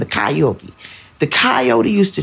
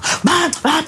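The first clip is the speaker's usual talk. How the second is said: put-on kids' whining protest; 14 semitones higher than usual.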